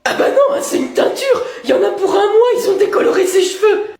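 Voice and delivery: high, loud panicked squeal; shrill outburst. Acted. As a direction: shriek it in dismay. Daughter shrieking about dye.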